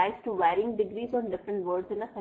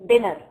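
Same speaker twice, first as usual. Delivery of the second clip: "Dinner" with a loud, falling first syllable; "Dinner" said loud as an emphasized word; stressed first syllable; 8 dB louder than usual. Shown as a demonstration. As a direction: hit the "Din" of "Dinner" louder and longer. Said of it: "louder and longer".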